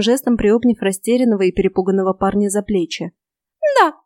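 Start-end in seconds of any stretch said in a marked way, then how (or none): 3.8-3.9 short, composed fairy reply; calm fairy. A narrator's reading. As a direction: confirm it without a qualm. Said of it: "спокойно подтвердила фея".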